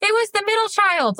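put-on high little-kid voice denying it; three-year-old's denial. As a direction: innocent high child voice blaming a sibling. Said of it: "And she's like".

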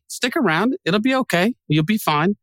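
mock reassuring. Host to wary listeners about to leave, mock reassuring. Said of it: "to them I say".

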